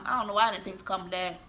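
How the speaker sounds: ordinary talk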